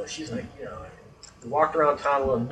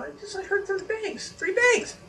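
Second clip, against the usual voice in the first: excited, high-pitched; 4 dB quieter than usual. Retold or imitated. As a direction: voice jumps high, excited disbelief. Excitedly retelling hearing bangs.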